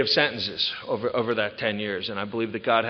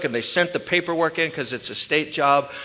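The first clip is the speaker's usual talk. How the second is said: ordinary delivery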